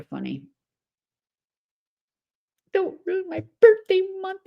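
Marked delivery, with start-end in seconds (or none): 2.7-4.4 high, whiny mock child. Mocking a grown woman crying over her birthday, high whine. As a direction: whine it like a spoiled kid. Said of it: "I find this extra funny".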